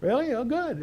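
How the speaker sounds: usual speaking voice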